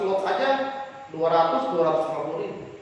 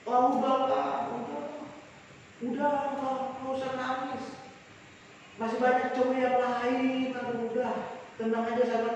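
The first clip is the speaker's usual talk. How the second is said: softer, falsely tender consolation; mock comforting; 2 semitones higher, 7 dB quieter than usual. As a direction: hush a sobbing friend, sweetly fake. Fake friend comforting heartbroken friend.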